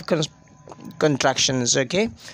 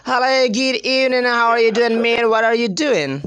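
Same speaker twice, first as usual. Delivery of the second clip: higher, run-together native English imitation; fast native English speaker; 4 semitones higher than usual. Shown as a demonstration. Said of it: "They speak really fast".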